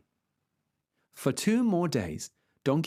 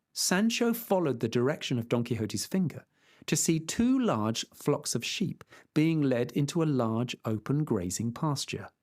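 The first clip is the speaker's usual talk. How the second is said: usual speaking voice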